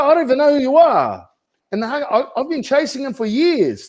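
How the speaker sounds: high-pitched comic exasperation; exasperated retelling. Retold or imitated. Agent telling coach how vendors hung up, high exasperated protest. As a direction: wail the grievance, voice pushed way up.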